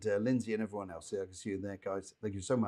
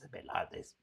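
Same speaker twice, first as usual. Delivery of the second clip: a rough imitation of a deep-voiced sick man; deep-voiced old man; 11 dB quieter than usual. Psychic imitating a dead man's deep voice, softly. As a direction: put on the late man's deep voice, unvoiced and soft. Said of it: "used to have a terrible deep voice".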